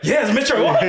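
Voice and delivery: high, loud, breaking into laughter; laughing reveal. Retold or imitated. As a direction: deliver the punchline laughing. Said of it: "And he's like".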